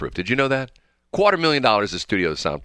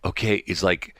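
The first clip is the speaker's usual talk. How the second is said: normal talking voice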